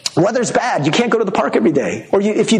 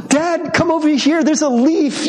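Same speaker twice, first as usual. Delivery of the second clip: put-on excited child; 7 semitones higher than usual. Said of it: "These children who are so enchanted".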